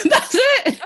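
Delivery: laughing. Real, not acted. Laughing at accent attempt.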